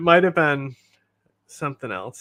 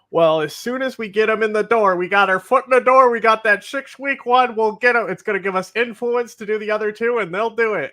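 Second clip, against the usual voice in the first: higher, louder mock official voice; mock Biden admin; 7 semitones higher, 6 dB louder than usual. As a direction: parody the administration's smug promise. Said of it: "but Biden's admin says".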